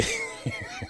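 a sudden high squeal of a laugh; high burst of laughter. Real, not acted. Laughs at own joke.

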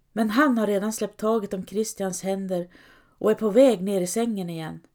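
ordinary delivery, nothing marked

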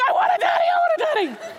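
hammed-up childish cry, laughing at herself; laughing mock wail. Telling the congregation her last moment there, a laughing high wail. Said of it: "The last anybody saw was me saying".

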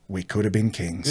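low, soft quoted musing; understated regret. Retold or imitated. Cousin musing to him on the lounges, understated regret. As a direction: say it quietly, dropping the pitch.

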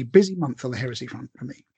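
ordinary talk, nothing marked

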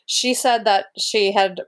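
usual speaking voice

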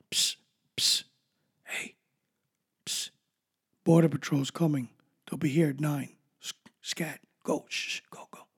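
hushed secret tip-off whisper; leaning in to whisper. Retold or imitated. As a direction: whisper it like a lookout. Mayor tipping off immigrants secretly.

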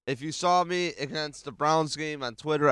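usual speaking voice

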